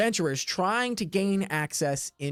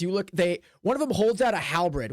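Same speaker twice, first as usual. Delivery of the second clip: ordinary delivery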